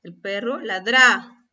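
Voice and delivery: "ladra" as la-DRÁ, stress on the end; wrong final-syllable stress. Shown as a demonstration. Teacher asks if we say it so, "ladra" stressed on its last syllable. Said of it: "Así es como pronunciamos esa palabra".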